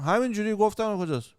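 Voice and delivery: lightly voiced shrugging local; mock innocent reply. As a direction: play it off as casual. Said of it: "مثلاً به من میگه".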